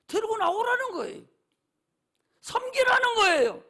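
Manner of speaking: shouted exhortation to serve; preacher's shout. Real, not acted. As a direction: shout the point out. Climactic sermon point.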